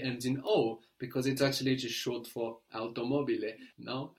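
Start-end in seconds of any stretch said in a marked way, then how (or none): none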